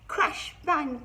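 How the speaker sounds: loud booming crash; thunder crash. Acted. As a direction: shout the thunder. Thunder sound.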